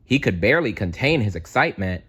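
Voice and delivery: usual speaking voice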